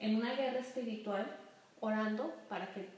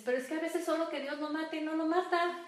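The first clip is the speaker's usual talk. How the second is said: higher, louder whiny complaint; mock complaining listener; 6 semitones higher, 4 dB louder than usual. Woman grumbles to her pastor, higher and louder. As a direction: play the frustrated believer grumbling. Voicing listener's wish, quoted.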